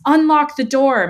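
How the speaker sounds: high, loud command; urgent shout. A narrator's reading. Dad shouting from the other side, urgent command. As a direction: shout the order through the door. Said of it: "my dad shouted from the other side".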